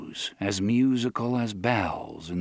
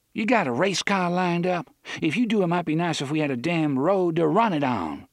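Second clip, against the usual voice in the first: mocking Susanna; 7 semitones higher than usual. Susanna mocks the journey.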